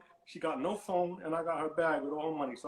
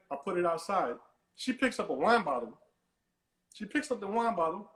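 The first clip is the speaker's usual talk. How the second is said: ordinary delivery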